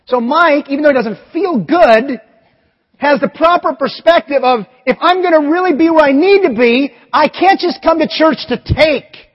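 loud, rising sermon climax; full-voiced pulpit preaching; preaching at full force. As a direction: preach it out to the whole room.

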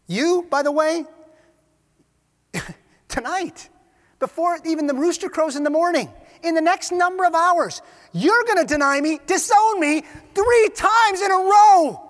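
high, loud pronouncement building to a climax; emphatic prediction. Acted. Jesus warns Peter he will deny him, emphatic. Jesus foretells the denial, acted.

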